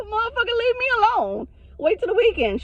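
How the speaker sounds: high-pitched, half-laughing exasperation; comic high-pitched pleading protest; exasperated rebuff. Retold or imitated. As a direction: whine at them to back off. Acting out rebuff of boyfriend.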